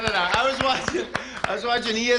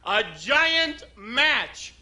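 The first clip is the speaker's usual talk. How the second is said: giant match joke; 5 semitones higher, much slower than usual. Pretend. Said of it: "you gotta watch real close".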